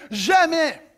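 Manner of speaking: high, loud mock refusal; defiant outburst. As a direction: act out a firm "never". Voicing a listener's defiant refusal.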